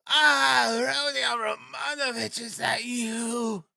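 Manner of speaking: very high, stretched-out frantic cries; crying out. A narrator's reading. Frantic widow railing at Sonia, then hailing Rodion, crying out. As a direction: cry out wildly, dragging the words. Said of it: "she cried".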